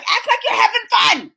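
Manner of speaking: high-pitched mock yell at the kids; re-enacted exasperated shouting; imitating her own yelling. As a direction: shout it like a fed-up parent. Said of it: "I was yelling at them like that".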